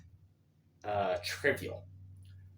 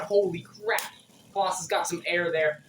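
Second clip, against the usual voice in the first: excited; 5 semitones higher, 5 dB louder than usual. Real, not acted. Reacting to the top jumping.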